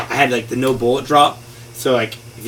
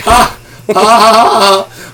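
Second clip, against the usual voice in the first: high, loud, slow mocking laugh; boss villain laugh; 9 semitones higher, 9 dB louder, much slower than usual. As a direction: cackle like a smug game boss. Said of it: "they're like".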